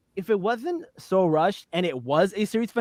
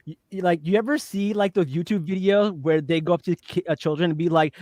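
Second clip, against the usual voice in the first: normal talking voice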